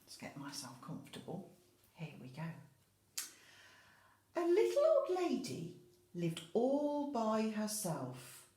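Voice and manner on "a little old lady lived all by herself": story narration. A narrator's reading. Teacher reading to the class, lively storytelling voice. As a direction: read it like a picture-book opener.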